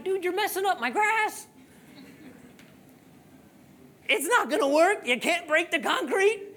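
high-pitched retold pleading with a dog; exasperated scolding. Scolding his digging dog, retold.

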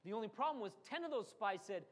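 normal talking voice

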